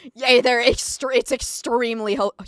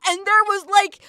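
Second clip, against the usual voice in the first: ordinary talk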